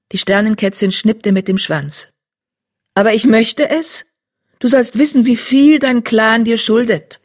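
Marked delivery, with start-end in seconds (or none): 2.9-7.2 higher, louder earnest she-cat; insistent she-cat. Acted. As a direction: press the point kindly. Blaustern insists.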